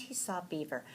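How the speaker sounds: ordinary delivery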